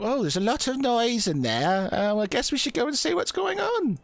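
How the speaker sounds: put-on high, quavering old lady; old woman. Old woman tells Arano to check the noise, high voice. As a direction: lilt it up like a curious old dear. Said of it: "the old woman sort of".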